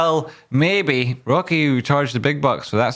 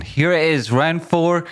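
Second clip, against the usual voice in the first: announcer hype; 4 semitones higher than usual. Real, not acted. Announcing quiz round.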